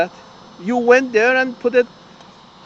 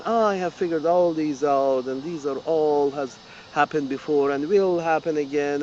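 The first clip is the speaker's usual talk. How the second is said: softer, smug mock confidence; confident expert; 5 semitones lower, 7 dB quieter than usual. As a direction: lightly mimic a know-it-all. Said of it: "with confidence I speak with you".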